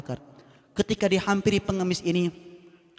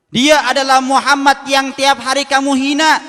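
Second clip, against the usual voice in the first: higher and louder, driving it home; emphatic reveal; 8 semitones higher, 9 dB louder than usual. Acted. Abu Bakar tells the beggar who fed him, emphatic. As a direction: proclaim the name with force.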